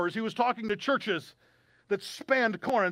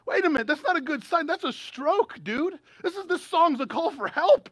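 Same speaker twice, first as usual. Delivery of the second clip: higher-pitched, mildly incredulous objection; mock alarm; 5 semitones higher than usual. Preacher reacting to a pop lyric as a stroke symptom, mock alarm. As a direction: protest in mock concern, pitched up.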